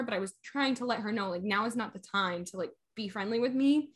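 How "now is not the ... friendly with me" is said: ordinary delivery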